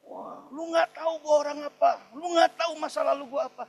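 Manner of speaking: high, loud, rough shouting back; rude angry retort. Retold or imitated. Ex-thug yells at his pastor, rude shout. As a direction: snap back like an ex-thug who's been cornered. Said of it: "ngomongnya kasar".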